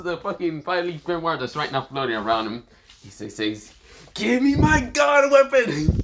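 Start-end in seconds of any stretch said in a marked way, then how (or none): 4.1-6.0 high, slow, loud yell; screamed demand. Acted. Hero demanding his god weapon, drawn-out scream. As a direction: stretch the command into a scream.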